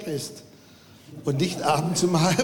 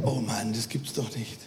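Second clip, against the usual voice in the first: annoyed thought; 8 semitones lower, 5 dB quieter than usual. Retold or imitated. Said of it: "der denkt".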